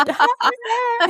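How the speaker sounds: high squeal of delight through a laugh; excited cheer. Real, not acted. Guest delighted the host will tell her sons, cheering.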